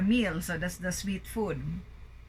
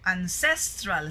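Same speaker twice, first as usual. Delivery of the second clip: "ancestral" with CES stressed, then falling; second-syllable stress. Shown as a demonstration. Teacher modelling the word, "ancestral" stressed on CES. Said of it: "it's in the second syllable".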